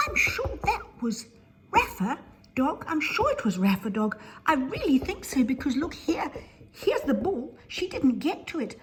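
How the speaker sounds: a cub who figured it out; little bear. Acted. Little bear tells the others she found the ball, eagerly. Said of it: "little Penny Bear says".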